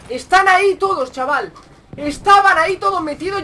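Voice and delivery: loud, high burst of disbelief; astonished shout. Real, not acted. Gamer finding all the enemies in one place, astonished shout. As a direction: exclaim it loudly, pitch jumping up.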